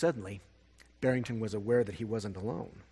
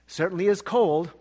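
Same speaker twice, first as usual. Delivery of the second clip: said out loud; 6 semitones higher, 6 dB louder than usual. Acted. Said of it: "Barrington said out loud".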